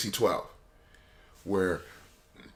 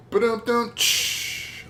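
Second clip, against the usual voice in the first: high, loud vocal rimshot ending in a cymbal hiss; comedic drum roll; 13 semitones higher, 5 dB louder, much slower than usual. Pretend. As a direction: mouth a drumbeat and cymbal crash. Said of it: "and a comedic drum roll".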